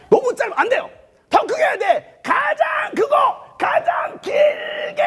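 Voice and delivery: high loud urging, stretched at the end; exhorting shout. Real, not acted. Preacher urging the congregation to cry longer, shouting.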